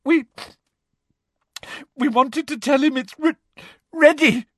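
high, sobbing stammer; halting fake tears; choked up. A narrator's reading. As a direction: choke before the last word and burst it out. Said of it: "choked Hermione".

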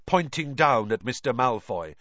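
usual speaking voice

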